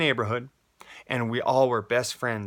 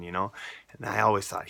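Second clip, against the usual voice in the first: normal talking voice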